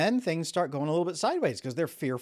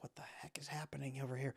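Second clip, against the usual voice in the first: whispered, baffled aside; bewildered whisper; 15 dB quieter than usual. Retold or imitated. Coworkers stunned by the outburst, whispering.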